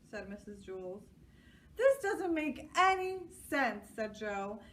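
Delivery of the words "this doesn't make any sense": put-on high, loud frustrated boy; frustrated child. A narrator's reading. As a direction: let the boy's frustration burst out. Joe frustrated.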